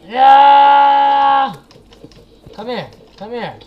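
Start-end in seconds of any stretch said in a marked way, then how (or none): 0.1-1.6 long loud battle yell; triumphant yell. Real, not acted. Attacking the eyeball boss.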